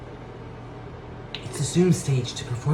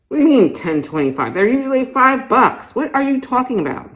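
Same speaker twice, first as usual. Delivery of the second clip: louder, higher, fast indignant outburst; outraged protest; 10 semitones higher, 6 dB louder than usual. Acted. Shopper balking at the clerk's price, loud and indignant. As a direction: balk at the price.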